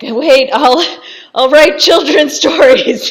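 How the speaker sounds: loud, high, hammed-up begging with a laugh; mock desperate plea. Marquis begging them to stop torturing him, mock desperate plea. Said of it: "trying to get them to stop torturing him".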